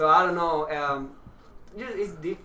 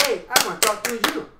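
slightly higher, louder mock confrontation; imitating animated talkers; 3 semitones higher, 7 dB louder, faster than usual. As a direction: put on an emphatic talker, louder. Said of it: "they just talk like".

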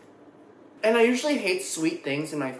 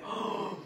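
soft gasp; 11 dB quieter than usual. Real, not acted. Tastes the dessert.

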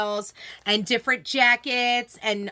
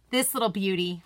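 usual speaking voice

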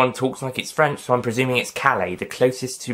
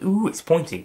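lightly voiced comic caveman, pitched up; funny caveman impersonation; 7 semitones higher than usual. Reviewer recalling the pointy character's line, lightly mimicked in higher pitch. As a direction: lift the pitch slightly clownishly, quoting a caveman. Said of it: "the one who said".

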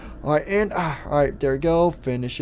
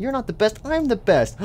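indignant rival; 6 semitones higher than usual. Acted. Rival racer disputes boast.

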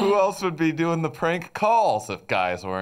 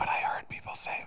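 whispering; 10 dB quieter, faster than usual. Acted. Keeps whisper bit.

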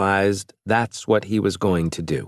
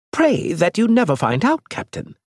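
higher-pitched alien envoy, scornful; lightly raised alien voice; alien Elge; 13 semitones higher than usual. Acted. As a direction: play the proud alien mocking the captain. Alien Elge ominous warning.

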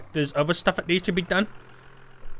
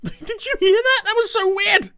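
very high, squeaky and laughing; high startled giggle; 5 dB louder than usual. Real, not acted. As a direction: shriek at a spooky noise. Reacting to weird sound.

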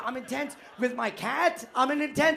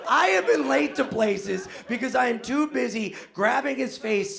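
normal talking voice

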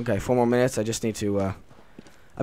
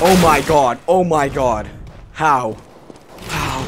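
shocked; 4 semitones higher, 8 dB louder, slower than usual. Real, not acted. Sudden kill while playing.

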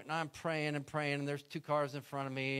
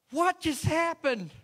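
much higher, louder, slow astonished cry; stunned disbelief; 10 dB louder, slower than usual. Retold or imitated. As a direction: gasp it out in total amazement. Van pushed through checkpoint.

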